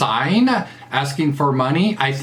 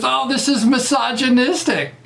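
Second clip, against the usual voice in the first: mocking accusers; 10 semitones higher than usual. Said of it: "the guy got into trouble because".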